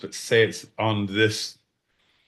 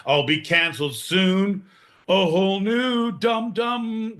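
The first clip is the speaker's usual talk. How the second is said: mock dramatic recital; 8 semitones higher, slower than usual.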